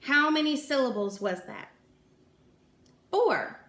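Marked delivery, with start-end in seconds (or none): none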